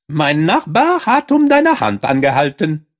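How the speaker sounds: high-pitched, eager mouse; field mouse voice. A narrator's reading. Field mouse passes on the neighbour's proposal, high mouse voice. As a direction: deliver the news in a high mouse voice.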